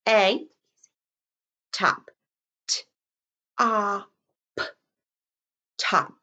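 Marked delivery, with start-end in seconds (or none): none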